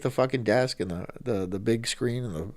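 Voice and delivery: ordinary delivery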